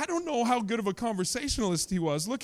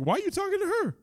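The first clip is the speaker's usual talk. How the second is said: much higher voice, disciples' indignant question; disciples' indignant squeak; indignant disciples; 13 semitones higher, 4 dB quieter than usual. Retold or imitated.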